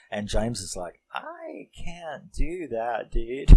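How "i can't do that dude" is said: high, drawn-out mock protest; appalled refusal. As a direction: stretch it out high, refusing a friend. Acted horrified refusal to James.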